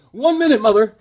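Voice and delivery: loud high call back; loud answer to mother. Retold or imitated. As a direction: shout back to the other room. Answering his mom.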